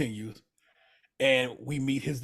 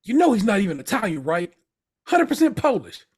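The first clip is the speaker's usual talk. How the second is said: higher, louder and fast, scoffing; mock Richie rant; 5 semitones higher, 5 dB louder than usual. Retelling Richie mocking the Polish guy, loud and quick. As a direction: fire off the insult with contempt. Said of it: "he goes".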